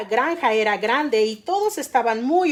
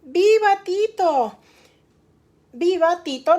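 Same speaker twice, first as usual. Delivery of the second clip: cheering shout; 4 semitones higher, 4 dB louder than usual. A narrator's reading. Storyteller voices everyone hailing the guard dog, cheering. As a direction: hail the hero with a cheer. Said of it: "gritaron todos".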